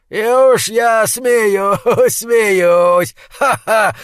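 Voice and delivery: высокий громкий смех сквозь слова; расхохочись, как безумец; безумный смех. A narrator's reading. Mad laughter in speech. (безумный хохот)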